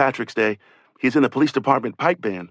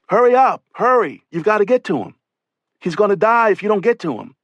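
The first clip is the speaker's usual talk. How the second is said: higher, louder, urgent pleading shout; urgent yell; 8 semitones higher, 4 dB louder than usual. Retold or imitated. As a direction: yell at them to hurry, desperate. Trapped cop urging rescuers on.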